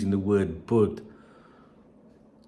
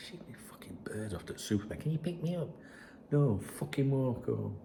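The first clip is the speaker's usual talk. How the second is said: hushed aside; 10 dB quieter than usual. Real, not acted. Aside to someone off mic.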